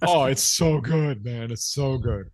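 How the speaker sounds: slow, mock-impressed gushing; gushing AI reviewer. Host mocking AI peer review, drawn-out gushing. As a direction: lay the fake praise on thick. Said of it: "of course, A I would say".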